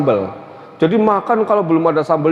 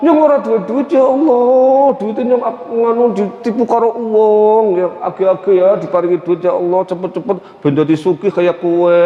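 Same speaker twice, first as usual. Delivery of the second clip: put-on Tegal accent; 3 semitones higher than usual. Tegal man praying to God for money, coarse Tegal accent. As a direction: plead to God in broad Tegal dialect. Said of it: "doa orang Tegal itu ... sekalipun kasar".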